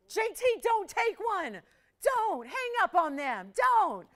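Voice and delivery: high, loud mock protest; playful pleading shout. Real, not acted. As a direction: yell don't at the operator in jest. Begging operator not to sell.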